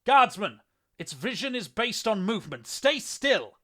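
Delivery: loud, high-pitched battlefield shout; ringing shout full of authority; commanding bellow. A narrator's reading. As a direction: bellow an urgent order to your comrade. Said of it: "bellowed to his comrade, his voice ringing out with authority".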